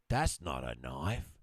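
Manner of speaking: a hint of Dundee, low and slow; Dundee quote. Husband retells the mugging scene, low slow Dundee line. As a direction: play it cool and unimpressed, slow. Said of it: "Crocodile Dundee goes like this".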